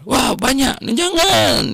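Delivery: high startled exclamation; grandpa's surprise. Retold or imitated. Preacher playing grandpa shocked at ten dollars. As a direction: gasp at the price, pitch way up.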